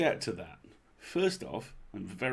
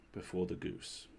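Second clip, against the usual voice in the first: hushed ominous; 6 dB quieter than usual. A narrator's reading. Ominous line in story.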